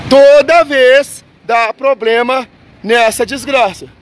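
slow, hammered-out complaint; emphatic exasperation. Real, not acted. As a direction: drag out each phrase in angry exasperation. Angry rant about the cash machine.

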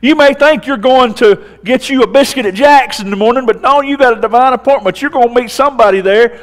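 loud, high Southern preacher's build; preaching at full force. Acted. Pastor urging his congregation, loud and rising. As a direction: drive it home to the congregation.

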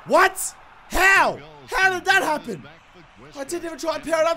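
can't believe what just happened; stunned disbelief. Real, not acted. Gamer shocked by his own fluke goal, disbelieving yelps.

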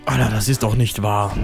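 ordinary delivery

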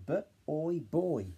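put-on B, oi, then boy; sounding out. Shown as a demonstration. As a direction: segment and blend boy. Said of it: "We check it".

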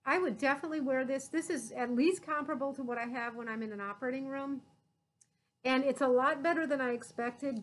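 muffled behind mask; talking through a face mask. Real, not acted. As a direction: mouth covered by mask. Seen 4: Pleated mask worn over nose and mouth throughout; reference frames only hold it.

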